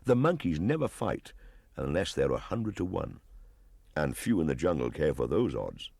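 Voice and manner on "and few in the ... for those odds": ordinary delivery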